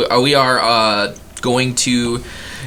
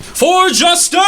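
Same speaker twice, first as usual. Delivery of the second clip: high shouted war cry; battle cry; 4 dB louder than usual. Acted. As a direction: charge off yelling for justice. Paladin charging off.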